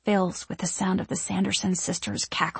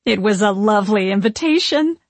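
loud, goofy smiling witch; goofy smile; 6 dB louder than usual. A narrator's reading. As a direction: say it grinning like a fool. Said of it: "with a goofy smile".

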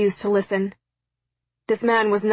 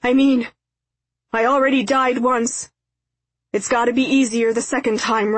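raised, heartfelt calling voice; desperate calling; 4 semitones higher, 4 dB louder than usual. A narrator's reading. Father reassures daughter up the hill, desperate calling. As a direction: call out to her, high and earnest, easing off.

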